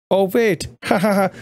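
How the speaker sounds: high, hammed-up evil chuckle; mock villain laugh. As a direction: laugh at your own wicked idea. Villain laughs at own threat.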